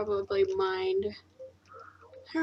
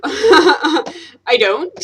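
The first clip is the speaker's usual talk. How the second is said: laughing out the words, higher and louder; laughing denial; 7 semitones higher, 10 dB louder than usual. Real, not acted. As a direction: laugh it off as you deny it. Denying stereotype while laughing.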